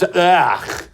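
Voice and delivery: drawn-out, throaty groan of disgust; disgusted ugh. Acted. Mocking opponents who hate your values, disgusted groan.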